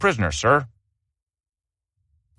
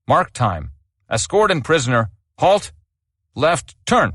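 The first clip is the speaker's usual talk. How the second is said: shouted drill commands; 2 semitones higher than usual. Acted. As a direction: snap out each order to the squad. Sergeant major drills the escort.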